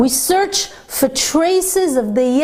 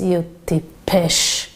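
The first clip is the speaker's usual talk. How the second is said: contemptuous insult; 6 semitones lower, slower than usual. Retold or imitated. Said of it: "the businessman turns to this wagon driver and he says".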